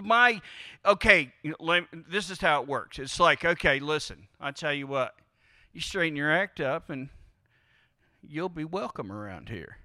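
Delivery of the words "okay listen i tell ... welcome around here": stern conditional lover. Retold or imitated.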